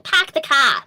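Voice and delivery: put-on Boston accent. Shown as a demonstration. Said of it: "Like, the generic accents".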